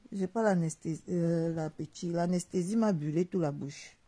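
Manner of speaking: usual speaking voice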